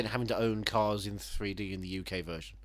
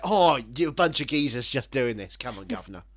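mock Cockney geezer; 6 dB louder than usual. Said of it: "That's what he was saying".

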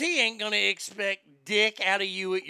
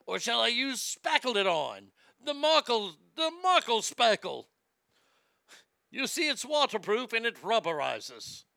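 higher hammed-up British pitchman; mock-British infomercial pitch; 4 semitones higher than usual. Harry selling spackle to TV viewers, hammed-up British accent. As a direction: sell it big in a bad British accent. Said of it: "It's all going Australia for some reason".

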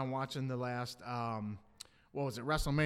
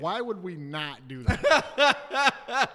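laughing disbelief; 7 dB louder, much slower than usual. Real, not acted. Laughing excited proposal.

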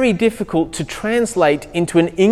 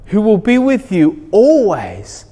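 normal talking voice

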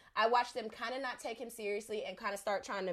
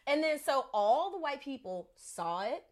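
ordinary talk

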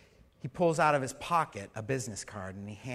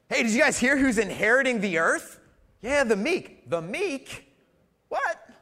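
mock gossip; 9 semitones higher, 9 dB louder than usual. Mock dialogue about the meek.

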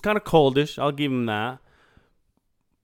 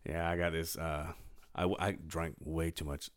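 low, soft voice still waking up; real just-woken voice; morning voice; 7 semitones lower, 13 dB quieter than usual. Real, not acted. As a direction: talk low and quiet, throat not yet cleared. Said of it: "your voice is waking up".

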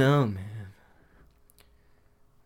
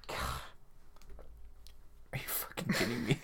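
whispered disbelief; whispering; 16 dB quieter than usual. Real, not acted. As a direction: hiss it in disbelief.

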